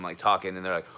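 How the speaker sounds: normal talking voice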